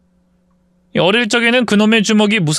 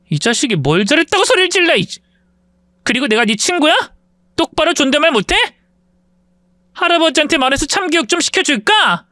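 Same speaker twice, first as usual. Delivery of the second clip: much higher, scolding threats; furious big sister; 11 semitones higher than usual. Acted. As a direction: put the brat in his place. Wife scolds cousin for shouting.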